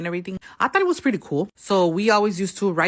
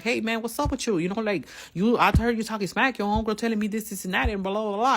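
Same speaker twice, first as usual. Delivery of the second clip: higher, challenging call-out; retold confrontation; 6 semitones higher than usual. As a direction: call her out to her face.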